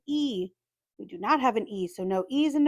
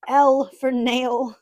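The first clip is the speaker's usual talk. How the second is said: slow, stretched and much louder; oddly drawn out; 2 semitones higher, 9 dB louder, much slower than usual. Real, not acted. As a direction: drag the words out and belt them. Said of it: "Why did I say it like that?".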